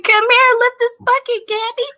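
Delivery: put-on high-pitched child calling her twin over; imitated little girl. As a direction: beckon in a put-on high kiddie voice. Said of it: "She's like".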